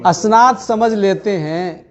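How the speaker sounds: measured Urdu poetry recitation; tender romantic poetry. Acted. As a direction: recite each couplet with feeling. Poet reciting at mushaira.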